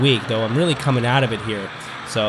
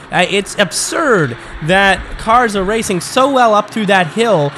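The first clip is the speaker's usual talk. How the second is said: raised, emphatic race commentary; live race play-by-play; 8 semitones higher, 5 dB louder than usual. Acted. Commentator decrying cars losing direction, emphatic raised play-by-play. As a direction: argue the absurdity, then roll on with the call.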